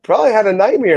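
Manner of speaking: mild put-on voice. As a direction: toss it off lightly. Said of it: "I'm like".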